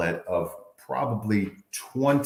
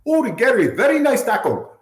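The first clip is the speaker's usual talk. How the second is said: high, loud Nigerian accent imitation; booming friendly Nigerian accent; 7 dB louder than usual. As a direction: cheerfully mimic a Nigerian opponent's praise in a high Nigerian accent. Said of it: "he would say in his Nigerian accent".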